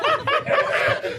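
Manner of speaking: high laughing squeal. Real, not acted. Laughing before confession.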